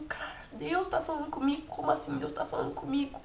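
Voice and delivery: quiet, bewildered re-enactment of a thought; stunned self-talk. Retold or imitated. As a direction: murmur it to yourself in retold shock. Retells stunned reaction to God's voice.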